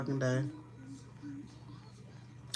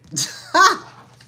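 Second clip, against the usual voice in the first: loud shrieking laugh; 11 dB louder than usual. Real, not acted.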